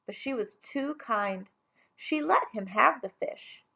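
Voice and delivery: ordinary delivery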